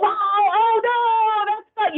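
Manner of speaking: match their upset with lively pitch; matching their emotion. Shown as a demonstration. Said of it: "You know, you're matching that".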